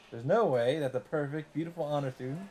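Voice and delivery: ordinary talk, nothing marked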